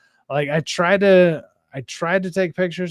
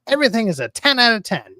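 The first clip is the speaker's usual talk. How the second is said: quick, higher gushing fan; mock shill; 5 semitones higher, faster than usual. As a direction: rattle it off like an uncritical fan. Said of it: "I don't think that's shilling".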